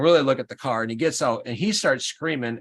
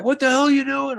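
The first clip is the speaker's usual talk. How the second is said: sudden high-pitched shout, much higher; imitating Sam yelling at the other driver; 13 semitones higher, 4 dB louder than usual. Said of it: "starts yelling over there".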